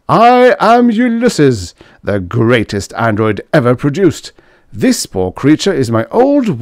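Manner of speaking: grand booming robot, high and loud; boastful android. A narrator's reading. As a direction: proclaim yourself the greatest machine. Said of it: "The android announced".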